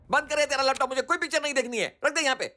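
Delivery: high-pitched, panicky scolding; agitated outburst. Acted. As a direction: snap at him in rising alarm. Scared uncle orders laptop shut.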